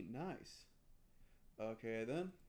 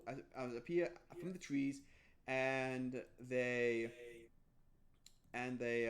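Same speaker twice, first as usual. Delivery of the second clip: normal talking voice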